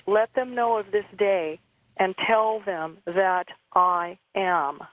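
measured, slowing to single words at the end; quoted decree, drawn out to a close; slow solemn pronouncement. Retold or imitated. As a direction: deliver a retold divine charge, slowly and deliberately. Quotes Jesus' solemn charge to her.

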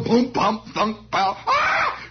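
punchy vocal thuds ending in a high cry; imitated battle blows. Storyteller imitating knaves being struck, thumps and a high cry. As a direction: act out blows landing, then yelp.

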